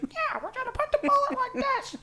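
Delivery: very high squeaky kid imitation; squeaky teen voice. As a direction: mimic a squeaky kid showing how it's done. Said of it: "the squeaky voice".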